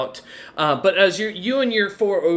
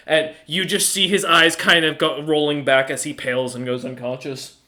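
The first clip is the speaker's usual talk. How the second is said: ordinary talk, nothing marked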